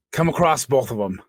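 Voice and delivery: ordinary talk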